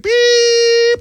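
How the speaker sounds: long high-pitched monitor tone; imitated flatline beep. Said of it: "unplugged her life support machine".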